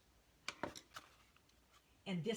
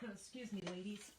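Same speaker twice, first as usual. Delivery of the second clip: normal talking voice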